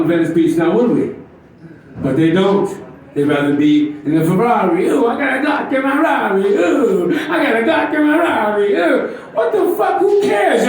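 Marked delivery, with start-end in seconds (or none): 4.9-9.1 higher, boastful mock flex; mocking braggart athlete. Mocking athletes bragging about a gun in their Ferrari. 9.3-10.7 high, incredulous outburst; exasperated yell. Real, not acted. Comic to audience, high exasperated outburst.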